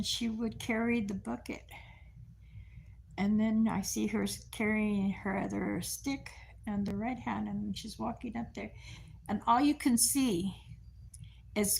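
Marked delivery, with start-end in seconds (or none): none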